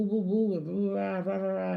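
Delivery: mock the noisy crowd's woo woo rah rah; hammed-up crowd chant; mock cheering. Imitating a rowdy chat cheering.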